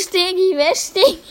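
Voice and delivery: high laughing question. Real, not acted. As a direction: giggle through the question. Laughing at the name Stegi.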